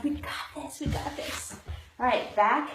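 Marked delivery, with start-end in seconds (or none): 0.8-1.6 whispered, breathy encouragement. Real, not acted. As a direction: whisper it to yourself. Repeated encouragement, second whispered.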